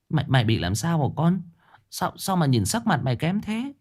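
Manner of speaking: high, fretful questioning; mother fussing over her pale son, anxious; worried mother. A narrator's reading. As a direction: voice climbing with worry.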